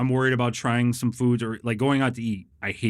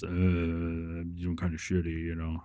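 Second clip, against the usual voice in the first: mopey retold answer; 5 semitones lower, 4 dB quieter than usual. Answering a friend asking about his weight loss, mildly dejected. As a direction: sigh it out like a man who has fallen off his diet.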